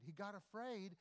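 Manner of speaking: normal talking voice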